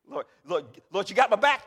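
loud, urgent appeal; calling on God. Acted. Believer asking the Lord for backing, loud appeal. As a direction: call out loudly to the Lord.

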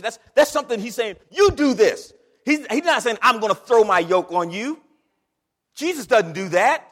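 loud, high call and response; full-force preaching. Acted. Pastor whipping up the congregation, loud high preaching. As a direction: shout it like the church is answering.

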